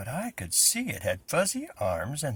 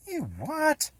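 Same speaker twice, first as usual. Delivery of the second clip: put-on much higher, shrill-free child's voice of disbelief; little sister; 3 dB louder than usual. A narrator's reading. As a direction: play the kid sister, amazed.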